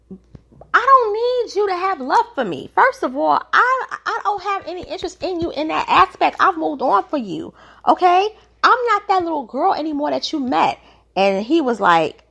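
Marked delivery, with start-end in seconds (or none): 0.1-10.8 much higher, heated retelling of her rant; mock angry British. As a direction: imitate her telling him off, pitched way up. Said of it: "But she went off".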